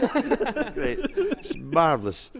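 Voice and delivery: slow, high, giggling sarcasm; drawn-out amused sarcasm; laughing mock praise. Real, not acted.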